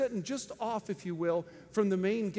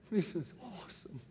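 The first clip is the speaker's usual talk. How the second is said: quiet awe; 5 semitones lower, 7 dB quieter than usual. Retold or imitated. Retells awed private thought in cage.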